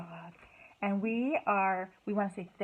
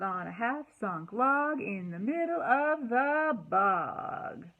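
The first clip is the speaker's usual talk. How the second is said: lively, singsong storybook reading, louder on refrains; reading to children; 2 semitones higher, 6 dB louder than usual. A narrator's reading. Teacher reading a cumulative picture book to kids, lively. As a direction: read it aloud with bounce for little ones.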